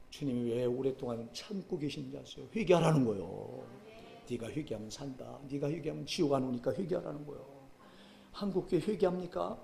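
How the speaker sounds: ordinary talk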